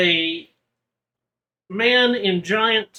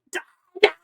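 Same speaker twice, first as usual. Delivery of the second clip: high-pitched manic attack cry; screaming creature; 13 semitones higher, 4 dB louder, faster than usual. Acted. Game master as a creature running at the players, screaming.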